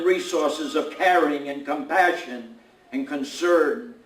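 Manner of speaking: solemn spoken invocation to God; prayer. Acted. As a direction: lift the voice in earnest prayer. Reverend moves into a prayer.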